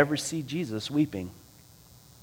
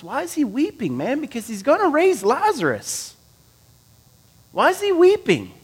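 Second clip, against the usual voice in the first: rising preacher fervor; 11 semitones higher than usual. Real, not acted. Preacher building rhetorical question.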